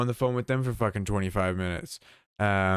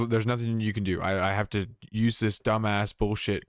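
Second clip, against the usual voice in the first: ordinary delivery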